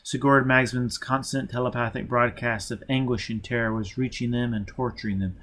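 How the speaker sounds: ordinary delivery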